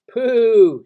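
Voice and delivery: loud, high, drawn-out call; shouting. A narrator's reading. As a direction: shout to a friend far off. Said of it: "shouted Christopher Robin".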